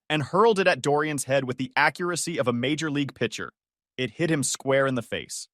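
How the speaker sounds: plain reading voice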